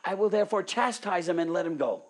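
high, loud pleading sermon voice; impassioned preaching. Acted. Preacher voicing Pilate pleading with the crowd, high loud preaching.

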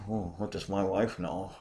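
dismissive husband, tossed off softly; mock lordly husband. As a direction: play a husband brushing off his wife under his breath. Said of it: "if I'm Mister Lord at all".

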